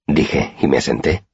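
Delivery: ordinary delivery, nothing marked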